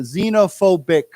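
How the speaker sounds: "xenophobic" loud and clear, starting with z; word modelling. Shown as a demonstration. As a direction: model "xenophobic" firmly as zee-no-phobic. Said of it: "let's say it as a 形容词".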